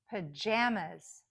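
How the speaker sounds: Pajamas slowly, stressed JA between two weak syllables; careful, slow model of Pajamas; middle-syllable stress. Shown as a demonstration. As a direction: lean on the middle of Pajamas, reduce the ends. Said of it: "sometimes the stress is in the middle".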